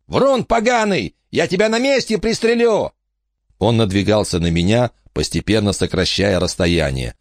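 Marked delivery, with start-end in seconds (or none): 0.1-2.9 high, furious threat; angry threat. Acted. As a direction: threaten to shoot him. Bandit threatens.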